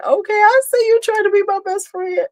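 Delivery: high mock-flattered sing-song; high teasing voice. Real, not acted. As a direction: tease her in a high playful voice. Teasing a chat commenter.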